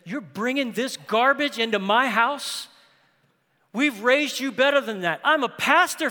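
imagining a furious scolding; mock tirade. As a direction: rant like an outraged pastor father. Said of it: "could have gone on a tirade".

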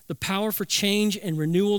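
normal talking voice

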